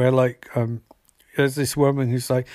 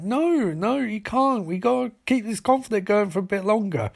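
mock protest; 8 semitones higher than usual. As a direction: whine out a refusal in a raised voice. Acted refusal in retold quarrel.

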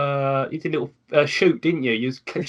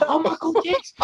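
sudden high burst of delight; excited; 11 semitones higher than usual. Real, not acted. Host thrilled by a new show idea, much higher. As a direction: squeal it out as the idea hits.

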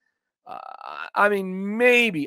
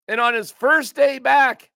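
usual speaking voice

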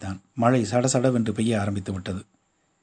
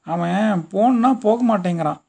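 complaining; 7 semitones higher, 4 dB louder than usual. A narrator's reading. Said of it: "புகார் பண்ணுவதைப் போல சொன்னாள்".

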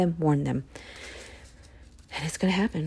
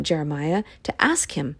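ordinary talk, nothing marked

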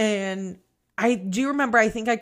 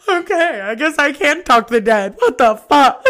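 loud, half-crying mock freak-out; overwhelmed disbelief; 4 semitones higher, 8 dB louder than usual. New medium learns her reading was right, overwhelmed disbelief. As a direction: exclaim it loudly, stunned by yourself.

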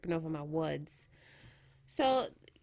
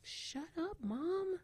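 put-on high, snappy teenage brush-off; a hint of a grumpy daughter; annoyed teen; 10 semitones higher, 5 dB quieter, much slower than usual. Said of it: "I wouldn't be surprised if she's like".

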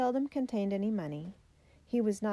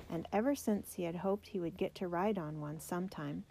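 usual speaking voice

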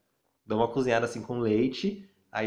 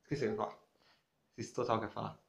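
fading off, lost in thought; trailing off; 2 semitones lower, 10 dB quieter, much faster than usual. Real, not acted. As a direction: voice drops away as the thought goes. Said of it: "Deu branco".

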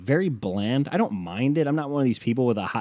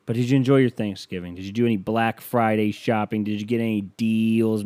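normal talking voice

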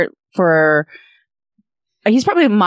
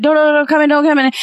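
flustered mom; 6 semitones higher, much faster than usual. Retold or imitated. Mom voiced in retold joke.

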